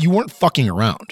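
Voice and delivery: normal talking voice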